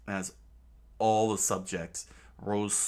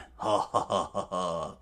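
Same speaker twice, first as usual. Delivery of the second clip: gloating laugh; 4 dB louder than usual. Acted. Crocodile reveling as the war begins, smug laugh. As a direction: savor the chaos with a chuckle. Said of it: "reveled in joy".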